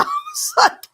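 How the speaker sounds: real laughter through the word; giggling mid-story; laughing. Real, not acted. Laughing while retelling.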